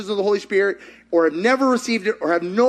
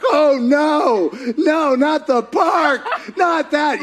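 mock panic; 8 semitones higher, 4 dB louder, much slower than usual. Kim panics at God's answer.

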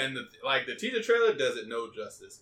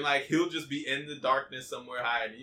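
ordinary talk, nothing marked

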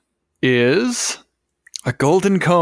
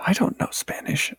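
whispered aside; 3 semitones higher, 8 dB quieter than usual. Real, not acted.

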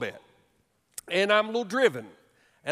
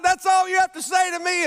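much higher, louder indignant yell; yelling over blower; 11 semitones higher, 6 dB louder than usual. Retold or imitated. Husband yells at wife over the running blower, loud and indignant. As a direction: shout it over a roaring leaf blower. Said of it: "I'm yelling over the leaf blower".

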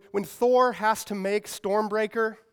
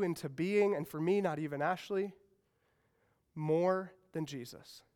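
soft tender close; 3 semitones lower, 5 dB quieter than usual. Real, not acted. Preacher gently telling students they are loved, soft and tender. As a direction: draw them in gently, almost hushed.

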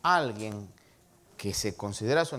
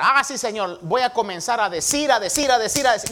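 loud, raised, repetitive parody of a zealous believer; mock eager promise; 6 semitones higher, 7 dB louder than usual. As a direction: imitate someone loudly promising to preach, on and on. Mimics believer who only talks.

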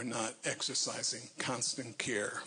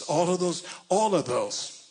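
loud preaching cry; 5 semitones higher, 6 dB louder than usual. Real, not acted.

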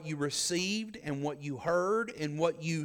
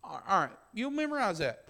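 ordinary talk

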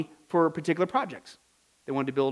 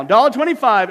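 auctioneer call; 4 semitones higher, 9 dB louder than usual. Retold or imitated. Auction price call repeated.

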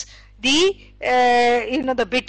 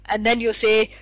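normal talking voice

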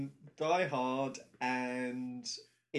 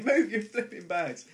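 mock grumpy passenger; 8 semitones higher, 9 dB louder than usual. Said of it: "pick on somebody and say".

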